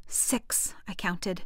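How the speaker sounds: whispered counting. A narrator's reading. Counting between lightning and thunder.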